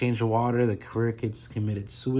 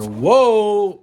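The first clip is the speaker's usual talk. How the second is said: sudden loud whoa, nearly dropping the phone; a real jolt of surprise, loud and high; startled whoa; 8 semitones higher, 11 dB louder than usual. Real, not acted. Nearly drops his phone.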